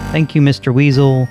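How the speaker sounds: ordinary delivery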